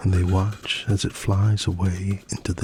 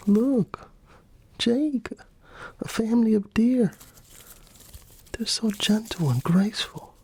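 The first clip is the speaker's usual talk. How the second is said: put-on much higher, hushed excited girl; high girlish Sarah. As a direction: point out the deer in a bright high voice. Sarah voiced in story.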